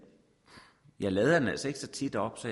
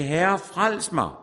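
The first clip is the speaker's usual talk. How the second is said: crying out; 6 semitones higher, 4 dB louder, much slower than usual. A narrator's reading. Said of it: "råbte han og sagde".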